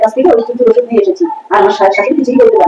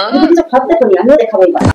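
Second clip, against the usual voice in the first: usual speaking voice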